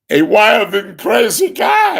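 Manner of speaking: high, loud comic shout; wild and crazy guy. Retold or imitated. Host imitates old comedy character, wild high shout. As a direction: yell it as a zany catchphrase. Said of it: "Remember him?".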